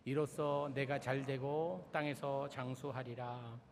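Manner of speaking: slow, measured Bible reading; scripture reading. Acted. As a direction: read the verses out solemnly, phrase by phrase. Reads scripture aloud. (천천히 낭독하는 성경 봉독)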